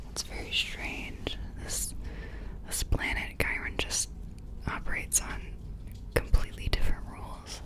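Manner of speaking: quietly puzzling it over, half to oneself; whispered musing. Acted. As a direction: think aloud in a soft whisper. Whispered musing within the roleplay.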